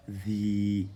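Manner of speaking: "vi" with a long, level i; lengthened vowel. Shown as a demonstration. Teacher reading out the lengthened letter, long held vowel. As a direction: hold the i of "vi" steadily. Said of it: "ça sert d'outil d'allongement à la voyelle i".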